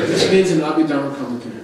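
ordinary delivery

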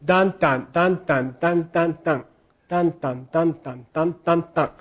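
even chanted beats, each dan stressed the same; rhythm beats. Shown as a demonstration. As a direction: tap out the meter evenly with dan dan. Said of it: "just a note when you're doing poetry".

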